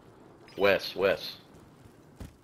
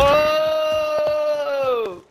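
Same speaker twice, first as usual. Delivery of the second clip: startled shout. Real, not acted. Sudden shout in firefight.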